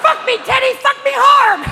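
very high, loud squawked moan; parrot mimicking porn. Parrot repeating porn to dinner guests, loud squawk. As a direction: parrot screeching a porn line. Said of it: "He's been watching porn".

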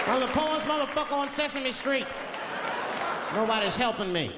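whiny high complaint from the trash can; high put-upon grouch; high Oscar voice. Retold or imitated. Oscar answering.

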